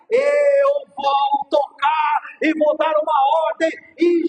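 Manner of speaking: loud, building preacher's cry; preaching at full force. Acted. Pastor preaching to mothers on Mother's Day, full force. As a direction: proclaim it to the whole church.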